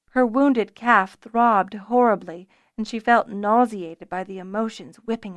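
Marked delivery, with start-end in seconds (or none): none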